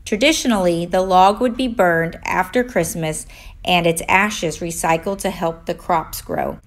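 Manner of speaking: ordinary delivery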